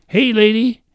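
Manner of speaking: high loud parrot call. Acted. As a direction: shout it like a cheeky parrot. Parrot calls to the lady.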